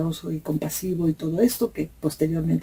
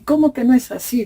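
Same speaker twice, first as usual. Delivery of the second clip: mock priest yelling; 5 semitones higher, 6 dB louder than usual. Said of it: "gritaba y gritaba".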